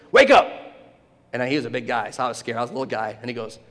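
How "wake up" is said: loud, high wake-up yell; imitating a gym teacher's bellow; teacher's shout. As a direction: jolt a dozing pupil awake. Gym teacher waking a sleeping pupil.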